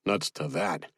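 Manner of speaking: low, quiet dismissive refusal. A narrator's reading. Refuses to leave injured man.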